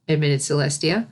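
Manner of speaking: usual speaking voice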